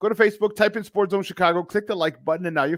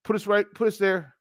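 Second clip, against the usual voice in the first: ordinary delivery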